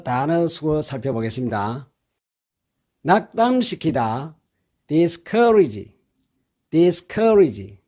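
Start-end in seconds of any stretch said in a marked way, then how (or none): 4.9-7.8 discourage said very slowly, each syllable clear; careful pronunciation. Shown as a demonstration. Teacher drilling discourage syllable by syllable. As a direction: spell out discourage slowly for learners. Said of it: "이렇게 발음합니다".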